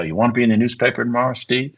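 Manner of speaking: rapid, teasing question; quick aside. Retold or imitated. Teasing his partner about the paper, quick aside. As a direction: rattle it off fast and sly. Narrator quoting himself to partner.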